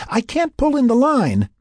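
very high, strained-sounding yell. A narrator's reading. Laura struggling with Frank's safety line, yelling. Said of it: "Laura yelled".

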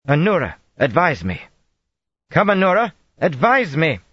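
high, loud, calling out; urgent plea. A narrator's reading. Frightened queen calling her advisor across the hall, urgent. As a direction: summon help in growing panic.

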